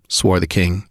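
ordinary delivery, nothing marked

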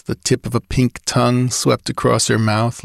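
ordinary delivery

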